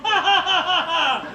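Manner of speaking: high, put-on ha-ha-ha; mock laughing. Speaker as Cub Scouts laughing at leader, mock laughing. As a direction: imitate boys' big forced laugh. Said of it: "And they go".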